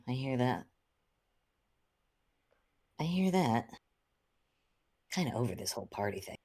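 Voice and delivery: ordinary delivery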